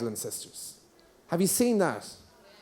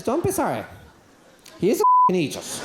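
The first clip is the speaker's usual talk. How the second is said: mock-exasperated mother, high emphatic pitch; 8 semitones higher than usual. Mother cutting off an apology about her son, exasperated.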